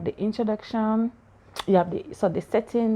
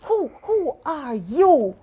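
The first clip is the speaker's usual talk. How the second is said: a hint of a startled princess, slow then snapping get out; hammed-up princess chasing off a stranger; mock princess; 5 semitones higher, much slower than usual. Acts the princess waking up.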